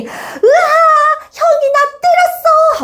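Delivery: high, wailing little brother; crying child. Acted. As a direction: wail and tattle through mock tears. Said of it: "하고 울어버린 거야".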